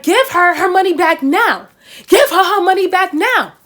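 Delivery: loud, high mock demand, mounting with each repeat; really mad. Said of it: "he was really mad".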